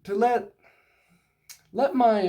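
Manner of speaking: dramatic build. Real, not acted.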